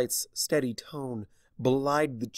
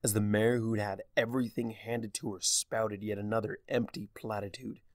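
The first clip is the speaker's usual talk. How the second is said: ordinary delivery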